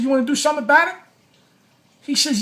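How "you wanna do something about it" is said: higher, louder retold dare; mock dare. Daring the cameraman to fight, a higher, louder mock challenge. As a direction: push the fight a step further, loud and high.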